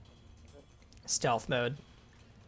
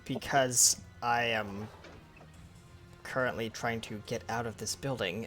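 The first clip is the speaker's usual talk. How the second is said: low character voice; 5 semitones lower, 7 dB quieter, slower than usual. Acted. Dracathio answers the pixie.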